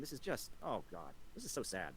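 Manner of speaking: low, soft mock sorrow; mock pity. [name] pitying the critic in a low, soft voice. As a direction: pity him, sarcastically.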